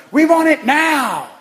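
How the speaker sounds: high, loud, drawn-out shout out a window; imitated commercial yell. Preacher mimics people yelling out the window in an old commercial, mock yell. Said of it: "yell out the window".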